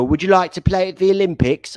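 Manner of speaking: high, loud mock British reporter asking a question; a hammed-up British accent on a reporter's question; British interviewer accent. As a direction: play a British sideline interviewer with a mic in a footballer's face. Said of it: "the interviewer is British".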